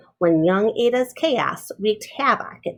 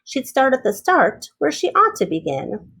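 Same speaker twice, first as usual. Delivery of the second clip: plain reading voice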